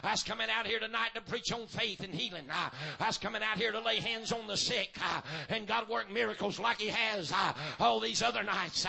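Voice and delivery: chanted, whooping preacher cadence with a gasped "uh"; full-force preaching. Acted. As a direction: preach it out hard, hammering each line.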